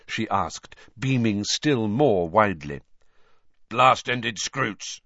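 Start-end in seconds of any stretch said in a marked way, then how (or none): 3.7-5.0 grunted. A narrator's reading. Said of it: "grunted Hagrid".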